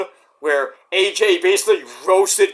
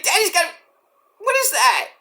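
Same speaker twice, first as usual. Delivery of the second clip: put-on confused little kid; 10 semitones higher than usual. Toddler telling mommy daddy is bleeding, high and confused. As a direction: stammer like a shocked toddler.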